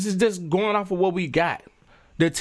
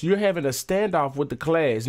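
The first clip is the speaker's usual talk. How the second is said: usual speaking voice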